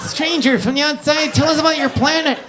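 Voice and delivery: high, loud chanting little green aliens; Toy Story aliens. Retold or imitated. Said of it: "Toy Story, the wee green aliens".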